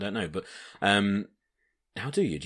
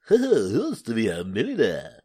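game show host; 2 semitones higher, 3 dB louder than usual. Pretend. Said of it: "It would be Tarrant".

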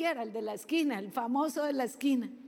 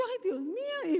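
higher, a mock-frightened "Ay, Dios mío"; startled gasp; 7 semitones higher than usual. Preacher retelling her scare at the pig-like dog, mock fright. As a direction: replay the fright at the odd dog. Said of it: "hice así".

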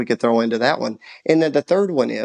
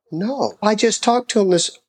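surprised; 9 semitones higher than usual. Retold or imitated. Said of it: "I was like".

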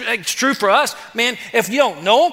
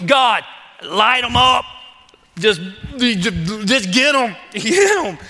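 mock fervent plea; 3 semitones higher, slower than usual. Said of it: "You're like".

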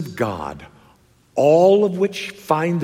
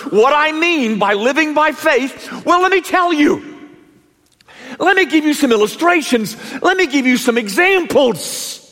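higher and louder, slowing on the build; preaching at full force; 11 semitones higher, 4 dB louder than usual. Acted. Preacher rousing the congregation, full-force preaching.

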